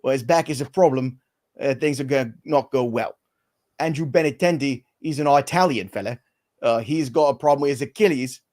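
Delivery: Cockney British impression; Michael Caine. Host reads baseball injuries as a Cockney actor, Michael Caine impression. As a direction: deliver it as a Cockney film star. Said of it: "I'll give you Michael Caine for the rest".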